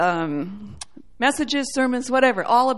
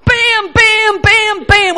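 loud, high, punchy impact noises; imitated volley, forcefully shouted; hell firing arrows; 7 semitones higher, 7 dB louder, much slower than usual. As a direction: fire off each hit hard. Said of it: "And hell's going".

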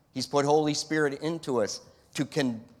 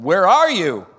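loud calling shout, pitched up; 5 semitones higher, 11 dB louder than usual. Shown as a demonstration. God calling out to hiding Adam, shouted. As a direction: bellow it across the garden. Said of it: "he's not shouting this".

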